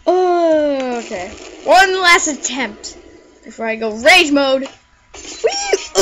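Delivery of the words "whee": very high gleeful whee; playful flying squeal. Pretend. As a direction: squeal as you fly. Character launched.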